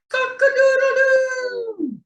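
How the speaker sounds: high, loud cock-a-doodle-doo; rooster crow. Acted. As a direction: crow out like a proud rooster at dawn. Rooster call in bird book.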